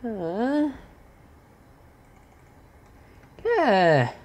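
high, loud gleeful burn; cackle-shout as flames fly; gleeful fire cast. Real, not acted. Casting fire spell.

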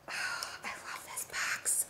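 slow, breath-only whisper; sigh, then whisper it slowly; hushed, overwhelmed love; adoring whisper. Real, not acted. Overcome by delight at box.

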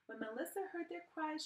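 ordinary delivery

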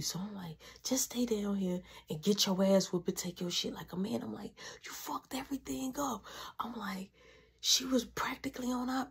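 soft whispered warning; hushed whisper. Retold or imitated. Warning brother about mom, hushed whisper.

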